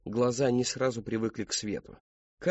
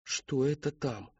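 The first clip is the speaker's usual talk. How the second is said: slow, higher, a little hushed with fear; fearful question; 4 semitones higher, slower than usual. Acted. Boy spotting something ahead in woods, afraid. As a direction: ask it warily, drawn out and uneasy. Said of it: "В голосе его слышался страх".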